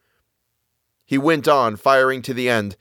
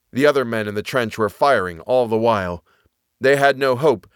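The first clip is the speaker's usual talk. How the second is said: ordinary delivery, nothing marked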